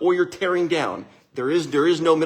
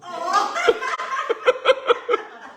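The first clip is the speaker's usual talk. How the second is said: long high laugh of delight. Real, not acted. Dad laughing at his daughter's words, high and long.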